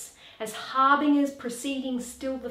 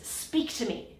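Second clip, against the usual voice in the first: ordinary talk, nothing marked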